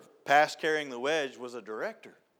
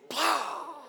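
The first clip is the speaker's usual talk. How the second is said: high sharp "Plow!"; crash sound; 11 semitones higher than usual. Retold or imitated. Truck backing over a septic tank, high crash sound. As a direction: make the noise of a tank caving in.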